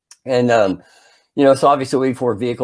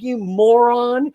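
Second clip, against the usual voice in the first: higher, slow, drawn-out insult; exasperated scolding; 8 semitones higher, much slower than usual. Retold or imitated. Boy running behind his friend, high exasperated scolding.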